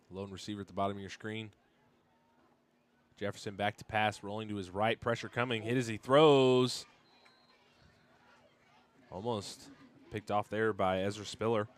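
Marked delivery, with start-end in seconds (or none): 3.2-11.7 live play-by-play. Real, not acted. Announcer calls the quarterback getting hit, excited play-by-play. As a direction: call the play as pressure closes in, rising to a shout.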